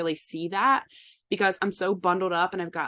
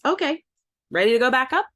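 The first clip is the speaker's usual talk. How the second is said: husband's chirpy prompt, higher and louder; perky husband; 6 semitones higher, 5 dB louder, faster than usual. Retold or imitated. Husband chirpily urging his wife to go back up, higher and louder. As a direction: bounce it like an eager husband. Husband cheerfully pushing her again.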